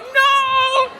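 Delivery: high, loud "no"; mock dismayed cry. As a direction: cry out in hammed-up panic. His dismayed reply to parents.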